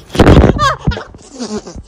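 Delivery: high, bursting laugh; startled laugh. Real, not acted. Mom sprayed by her child, high startled laughing. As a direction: shriek of laughter at a splash.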